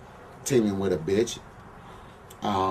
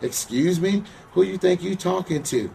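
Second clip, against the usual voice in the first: higher, rising indignant mock fury; retold angry outburst; 7 semitones higher than usual. To the wrestler on the plane, a retold indignant rage. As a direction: fire it back at the disrespectful man, voice climbing. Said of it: "I was like".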